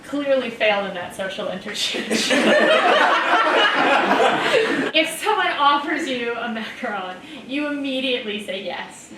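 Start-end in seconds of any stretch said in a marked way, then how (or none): 2.3-4.9 high, loud burst of laughter; big laugh. Real, not acted. Player bursts out laughing, high and loud, at Jake's story.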